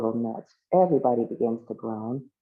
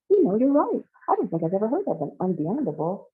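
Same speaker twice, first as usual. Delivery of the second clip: lightly voiced high, lively realization; tickled agreement; 7 semitones higher, 3 dB louder than usual. Kay agrees and jokes, in lively high pitch. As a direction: start high and loud, pleased with the joke.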